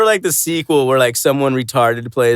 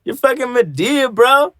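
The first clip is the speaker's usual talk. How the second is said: incredulous; 9 semitones higher than usual. Retold or imitated. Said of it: "it's like".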